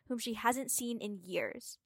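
usual speaking voice

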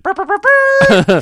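high, loud, slow brassy trumpet blasts; trumpet imitation. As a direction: blare it out like a toy trumpet. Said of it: "You come out with a trumpet".

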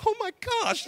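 very high-pitched imitation of shocked little kids; mock shocked kids. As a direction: squeal it like an astonished first grader. Kids reacting in classroom story.

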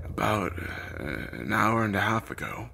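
halting, weakened man's answer; frail, broken-up reply; stuttering. A narrator's reading. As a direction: falter between words, searching for breath. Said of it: "he stuttered".

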